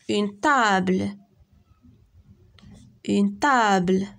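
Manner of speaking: slow, deliberate Une table; slow teacher repeating a pupil's word; slow model word. Shown as a demonstration. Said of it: "عطيوني شي كلمة فيها T".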